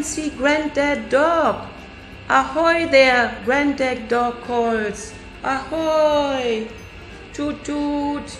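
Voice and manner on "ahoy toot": plain reading voice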